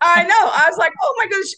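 high, loud, flustered; panicked. Retold or imitated. Speaker reliving the shock of the longer talk, panicked. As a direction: gasp it out in a panic.

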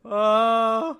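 high, loud blurted suggestion; audience shout-out. Acted. Audience member answering the improv comedian, mock shouted. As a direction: blurt it loudly from the crowd.

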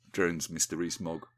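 plain reading voice

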